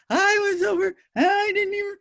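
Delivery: imitating an upset child trying to explain; upset sobbing child. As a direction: gasp it out like a kid fresh from a fight. Upset child from play yard.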